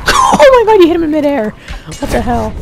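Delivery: high, loud amazed shriek; excited shriek. Real, not acted. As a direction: squeal in amazed disbelief. Partner hits zombie in midair.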